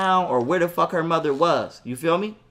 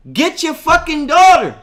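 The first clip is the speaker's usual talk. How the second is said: loud, high, drawn-out demand; angry demand; 9 semitones higher, 9 dB louder than usual. Retold or imitated. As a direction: hit each word hard, slow and raised. Retold angry demand to father.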